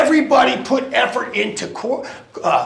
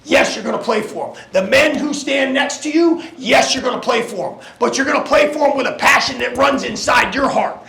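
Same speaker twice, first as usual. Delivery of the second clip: halftime pep speech; 5 semitones higher than usual. Acted. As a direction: build to a shout. Pep talk climax.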